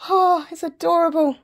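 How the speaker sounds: high, loud coo; delighted squeal of affection; adoring. Real, not acted. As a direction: gush over something cute. Cooing over dogs on t-shirt.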